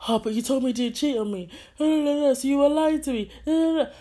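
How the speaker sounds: high, loud mock whining complaint; mock protest. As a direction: imitate his indignant protest in a high, insistent voice. Mocking replay of his protest.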